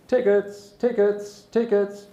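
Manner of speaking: high, repeated call down the carriage; ticket inspector call. Acted. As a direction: call out like a train conductor. Conductor calling in next carriage.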